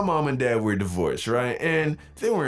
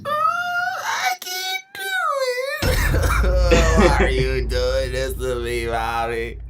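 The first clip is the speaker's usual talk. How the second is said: higher, slow, loud wailing cry; bawling; 6 semitones higher, 4 dB louder, much slower than usual. Retold or imitated. Scared kid wailing at mom over spelling, bawling. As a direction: wail it out like a broken-down child. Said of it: "I start bawling".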